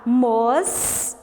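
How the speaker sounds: "Mos" ending on a long held S; isolated syllable. Shown as a demonstration. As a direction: stop on the S of Mos. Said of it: "parou no S". (Mos, parando no S prolongado)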